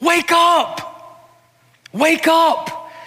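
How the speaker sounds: high, ringing call of Jesus; a call to wake the sleeping church. Acted. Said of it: "or Jesus would say".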